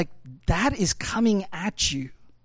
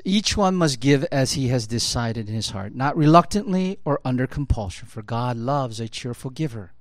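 lower, even reading of the verse; scripture reading; 4 semitones lower than usual. Acted. Pastor reading Corinthians to the congregation, measured reading. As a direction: read the passage steadily to the church. Reads Second Corinthians aloud.

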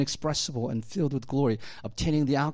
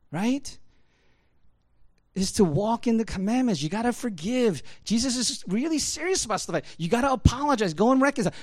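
high, loud, urgent preaching; full-force preaching; 9 semitones higher, 8 dB louder than usual. Acted. Pastor pressing the church to forgive and reconcile, preaching hard. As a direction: drive home the command to reconcile.

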